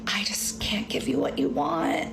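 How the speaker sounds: breathy, slow, overwrought whisper; mock dramatic boyfriend. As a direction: play the self-pitying boyfriend as a hushed slow sigh. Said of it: "I'm being dramatic because that's how he was".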